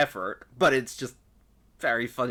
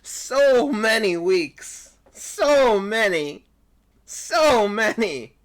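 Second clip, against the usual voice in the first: loud, high, drawn-out lament; exasperated wail; 10 semitones higher, 10 dB louder, much slower than usual. Real, not acted. Voice actor lamenting weeks of needless stress, loud drawn-out wail. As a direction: wail it slowly, dragging out each word.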